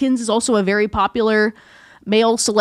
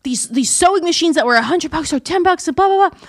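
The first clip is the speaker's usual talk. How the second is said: imitating excited mom; 6 semitones higher than usual. Voices mom excited about sale.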